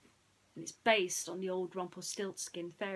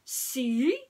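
proud prince; 6 semitones higher, 10 dB louder than usual. Acted. Prince shows the straw.

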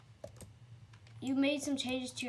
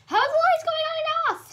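very high, very loud demand; shouted question; 13 dB louder than usual. Real, not acted. Gamer shouting to the server about the flickering lights. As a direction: yell it so everyone hears. Repeats question much louder.

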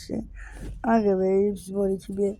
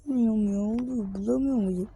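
usual speaking voice

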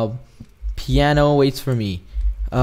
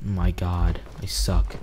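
low, soft aside to self; deflated; 8 semitones lower, 11 dB quieter, slower than usual. Real, not acted. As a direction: grumble it quietly, defeated. Fails the jump, deflated.

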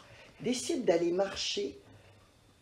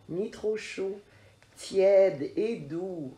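usual speaking voice

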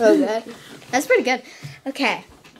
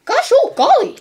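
higher, goofy cartoon voice; Goofy impression; 9 semitones higher, 4 dB louder than usual. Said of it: "That's really bad".

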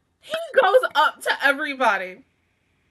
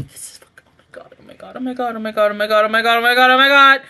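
whispered chant building to loud cries; mounting panic; 7 dB louder, much faster than usual. Real, not acted. Viewer facing her worst fear, mounting panic. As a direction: start voiceless, repeat and swell into loud alarm.

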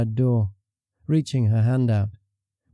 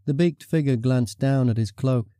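ordinary delivery, nothing marked

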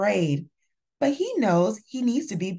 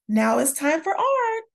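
announcing; 6 semitones higher, 3 dB louder than usual. A narrator's reading. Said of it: "the teacher announces".

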